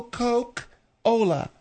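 "Coke-Ola" broken slowly into Coke, then Ola; split pronunciation. Shown as a demonstration. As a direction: pause inside "Coke-Ola" to show the word Coke. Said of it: "It's called Coke because".

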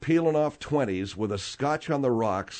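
ordinary talk, nothing marked

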